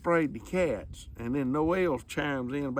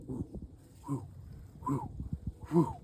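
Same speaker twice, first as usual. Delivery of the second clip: slow-motion whoosh; 7 dB quieter than usual. Retold or imitated. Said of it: "that thing going slow motion".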